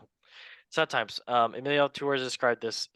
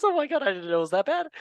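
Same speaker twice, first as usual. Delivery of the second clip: high, loud, rapid burst of disbelief; shocked aside; 13 semitones higher, 5 dB louder, faster than usual. Real, not acted. Host learning how bad the score was, real surprise. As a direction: blurt it out in high-pitched shock.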